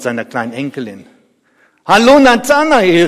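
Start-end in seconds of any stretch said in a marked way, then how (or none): 1.9-3.1 high, loud hail; lautes Rufen. Acted. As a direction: call out to a neighbour. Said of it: "rief ich". (freudig gerufen)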